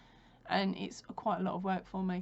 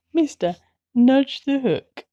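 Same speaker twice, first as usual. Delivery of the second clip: loud, slow, higher coaxing call; calling the dog; 4 semitones higher, 17 dB louder, slower than usual. Real, not acted. Telling the dog to nudge the crochet hook, calling out. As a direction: call out slowly, drawing out each word.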